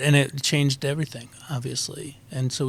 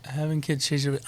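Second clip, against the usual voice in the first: ordinary talk, nothing marked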